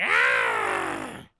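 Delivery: long, loud imitation scream; squeaky strained screech. Streamer imitating a game character's noise, strained screech. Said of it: "he still has that squeaky voice".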